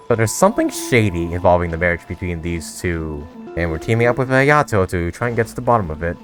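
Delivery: ordinary delivery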